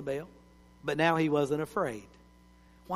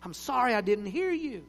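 put-on young man leaning close to the preacher; pleading question; 10 semitones higher, 6 dB louder than usual. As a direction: beg him to repeat it. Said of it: "right down and said".

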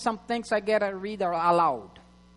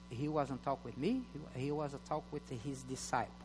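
reading to oneself; 7 semitones lower, 14 dB quieter than usual. Shown as a demonstration. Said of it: "that's how you have to read".

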